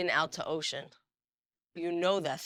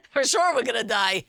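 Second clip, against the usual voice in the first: mock panic; 5 semitones higher, 8 dB louder than usual. Panicked passenger on turbulent flight.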